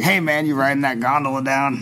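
lightly voiced old roadie; a hint of a weathered old-timer. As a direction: imitate an old hippie hailing a stranger. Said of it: "he talks like this".

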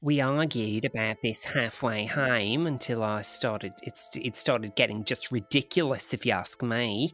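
lightly put-on Australian accent. Woman recounting a UFO encounter, in a rough Australian accent. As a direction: read it as an Australian witness. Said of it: "doing single-handedly to the Australian accent".

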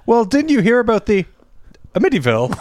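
long pause before "Amityville"; pause before name. Retold or imitated. Friend asking about the murders, pausing before "Amityville". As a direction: halt after "about the", then "Amityville" as uh-MIT-ee-ville. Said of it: "she pauses before it".

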